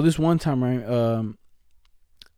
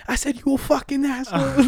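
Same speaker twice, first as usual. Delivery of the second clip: high-pitched mock-furious yell; hammed-up road-rage voice; mad lady driver; 12 semitones higher than usual. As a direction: imitate an irate woman driver, pitched way up. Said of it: "this one lady, bro, got so mad".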